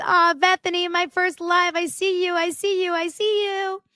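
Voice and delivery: very high put-on squeal of greeting; high-pitched gushing. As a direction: coo it way up high, imitating. Imitates someone's cooing high greeting.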